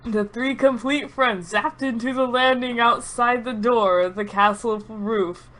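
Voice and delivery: ordinary talk, nothing marked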